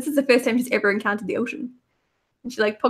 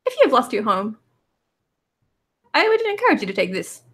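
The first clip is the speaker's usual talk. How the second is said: higher, cute little robot voice; chirpy tiny automaton, higher pitched; 4 semitones higher, faster than usual. Acted. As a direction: play a sweet little copper robot. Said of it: "and says".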